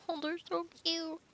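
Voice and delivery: high, soft cooing over something adorable. Real, not acted. Reader gushing over the cuddling beavers, high soft coo. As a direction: gush like you just saw a puppy.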